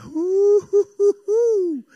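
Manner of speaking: high, drawn-out "ooh-hoo-hoo"; playful hooting. Acted. Old hippie husband teasing his wife, high playful hoot.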